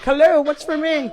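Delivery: chirpy pet voice asking for food; voicing the rooster landing on the counter while she eats. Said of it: "he'll fly up like".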